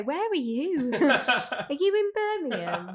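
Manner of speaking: high, loud quoted questions with a laugh; drawn out, incredulous teasing; mock questioning. Retelling how people react to the Brummy accent, mock questioning.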